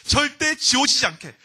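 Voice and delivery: loud, high and slow insistence; emphatic command. Retold or imitated. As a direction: drive the order home forcefully. God's command pressed home by preacher.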